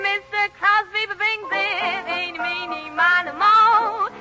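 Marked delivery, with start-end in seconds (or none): none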